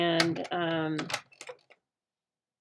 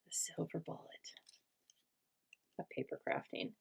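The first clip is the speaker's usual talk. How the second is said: whisper; 13 dB quieter than usual. Real, not acted. Crafting aside, hushed.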